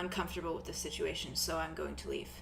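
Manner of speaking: soft, quick, awkward excuse; uncomfortable angel. Acted. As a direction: leave awkwardly and quietly. Zophiel uncomfortable exit.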